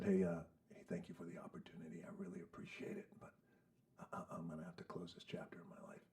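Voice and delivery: hushed whisper so the parents won't hear; quiet on the phone. Retold or imitated. Young man whispering to the coach on the phone. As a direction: whisper it, keeping it from the room. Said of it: "I tell the coach quietly".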